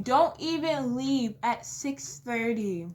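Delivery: normal talking voice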